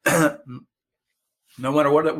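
normal talking voice

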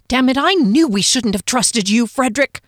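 loud, high angry cry; angry outburst. Acted. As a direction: rage at the traitor.

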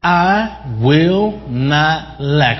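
I will not lack said slowly, each word hit; emphatic. Shown as a demonstration. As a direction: declare I will not lack, slow and firm. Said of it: "and emphatically".

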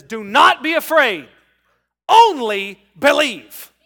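loud, slow commanding proclamation; Jesus commanding. Acted. Preacher voicing Jesus to the grieving father, loud and commanding. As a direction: declare it with full authority, stretching only believe. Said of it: "Jesus turns to him and says".

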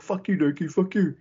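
high-pitched mock cursing; hammed-up parting insult, pitched high; imitated insult. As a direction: act out a wrestler cursing a rival. Co-host acts out the wrestler's insult.

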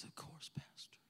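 soft breathy whisper; whispered reply. Acted. Mary answering the pastor, whispered. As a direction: barely breathe it.

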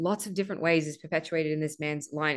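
ordinary talk

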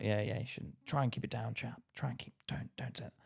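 soft, unvoiced advice to keep quiet; hushed warning. Nice boss quietly warning him to keep it down. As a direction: lean in and hush him. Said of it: "my boss was like".

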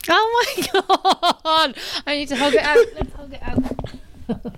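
high, moved laugh-talk. Real, not acted. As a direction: laugh through the words, moved.

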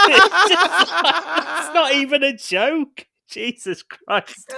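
high, loud laughing talk. Real, not acted. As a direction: squeal through the laughter. Laughing at bad line.

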